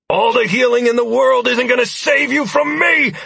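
high, loud defiant shout. A narrator's reading. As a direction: yell it in his face. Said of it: "I shouted at the man".

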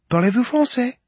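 put-on high, light French-accented woman. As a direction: voice a young Parisian woman. French vet assistant voiced.

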